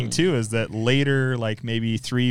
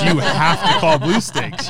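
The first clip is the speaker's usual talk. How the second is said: louder, slow, mock-stern insistence; more insistent board member; 5 semitones higher, 5 dB louder, slower than usual. Board member repeating warning, louder mock insistence. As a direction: lean on it harder, laughing. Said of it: "she's like".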